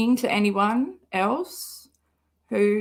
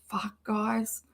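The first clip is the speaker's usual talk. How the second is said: whispered curse; 8 dB quieter than usual. Real, not acted. Muttered curse while driving.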